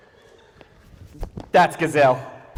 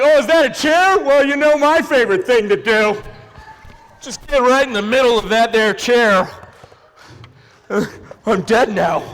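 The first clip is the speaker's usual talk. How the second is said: high, loud goofy character, dropping at the end; clueless Louie; 4 semitones higher, 5 dB louder than usual. Acted. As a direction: play a daft accident-prone character with gusto. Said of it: "It's just like".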